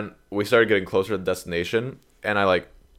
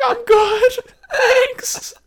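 very high squeaky voice, half laughing; mock panicky refusal; 5 dB louder, much slower than usual. Driver declining a stranger's smoke, comically high and nervous. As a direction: squeak it out, exaggerated nerves.

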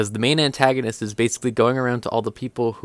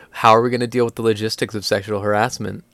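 ordinary talk

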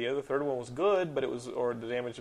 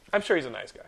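normal talking voice

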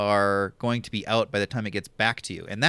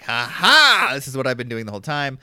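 gloating reveal; 7 dB louder than usual. Acted. Acted gloating reveal at game end.

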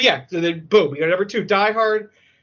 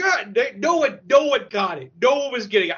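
higher, stammering frenzy; frantic disbelief; 5 semitones higher than usual. Retold or imitated. Moviegoer losing his mind at the twist, frantic stammer. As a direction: retell losing it, tripping over repeats.

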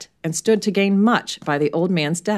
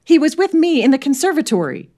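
high, loud, urgent outburst; bursting out; 10 semitones higher, 6 dB louder than usual. Acted. Janet bursting in to defend Merton, blurting. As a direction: rush in and blurt it.

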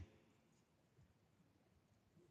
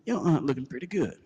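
impressed; 9 semitones higher, 7 dB louder than usual. Retold or imitated. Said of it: "And I was like".

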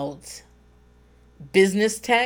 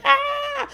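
much higher startled shriek; mock shriek. Handed a microphone, high mock shriek. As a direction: yelp at the microphone. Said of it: "and I'm like".

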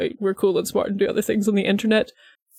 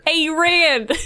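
put-on higher, brash Brooklyn accent call; 8 semitones higher than usual. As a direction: yell it like a street-corner Brooklyn kid. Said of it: "They all sound like Joey Wheeler".

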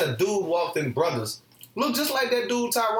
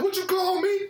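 very high, whiny cry of outrage; a high, whiny mock of the guy hearing himself called little boy; offended dude; 11 semitones higher than usual. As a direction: squeal it like he's hurt. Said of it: "He was like".

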